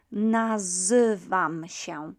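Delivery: "nazywam się" loud and slow, Y as "uh"; drawn-out Y sound. Shown as a demonstration. Teacher teaching the Y sound, slow, emphatic "nazywam się". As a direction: stretch each syllable of "nazywam się", the zy like "zuh". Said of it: "The Y sound, is pronounced as uh".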